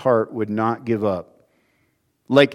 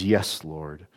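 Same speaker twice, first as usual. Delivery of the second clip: humble reply; 7 semitones lower, 6 dB quieter than usual. A narrator's reading. Woman yielding to Christ, low and humble. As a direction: lower and softer "Yes, Lord". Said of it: "Her reply is reverent and humble".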